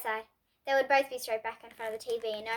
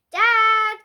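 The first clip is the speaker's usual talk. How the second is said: loud, high call to another room; 9 semitones higher, 11 dB louder than usual. A narrator's reading. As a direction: call for Dad to come. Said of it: "called A J".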